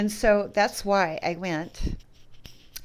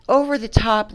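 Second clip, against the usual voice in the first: usual speaking voice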